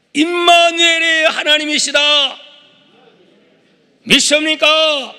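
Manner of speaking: loud, high rallying cry; preaching at full force. Real, not acted. Preacher asking the congregation to believe in Immanuel, fervent shout. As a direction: ring it out and demand an amen. Preacher proclaims, calls for assent.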